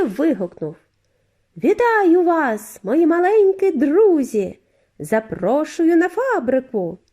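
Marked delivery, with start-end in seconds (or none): 1.6-7.0 high and clear like a flute, slow and welcoming; high clear Wonka voice. A narrator's reading. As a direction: greet the children grandly, high as a flute. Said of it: "Голос його звучав високо й чисто, мов флейта". (високий чистий голос, мов флейта)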